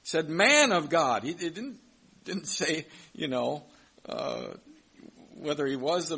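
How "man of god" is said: pleading. Retold or imitated. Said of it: "but pleaded with him".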